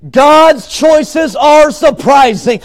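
slow, high, forceful proclamation; emphatic preaching. Real, not acted. Preacher returning to the refrain for the congregation, emphatic. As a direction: lift the voice and stretch every word.

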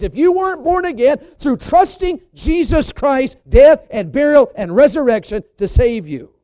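loud, high-pitched bursts on key words; full-force preaching. Real, not acted. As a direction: preach it with driving emphasis.

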